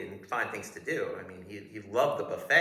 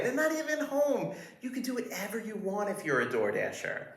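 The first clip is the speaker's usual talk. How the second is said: fawning guard voice. Retold or imitated. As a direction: gush like an overeager doorman. Guard voice again, pool offer.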